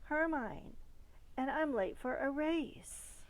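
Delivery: put-on a girl bursting with make-believe; child racing off. A narrator's reading. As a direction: rev up like a girl late for a race. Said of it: "Before speeding off".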